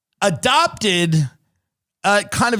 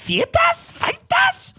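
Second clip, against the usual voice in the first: Feitas sung out much higher, mocking a South African accent; slower than usual. Mocking the South African accent to his cohost, much higher South African accent. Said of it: "you wanna talk about accents that are horrible?".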